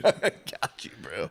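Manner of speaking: hushed, giggly reply in kind; secretive whisper. Acted. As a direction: whisper back, half laughing. Joins the joking secret pact.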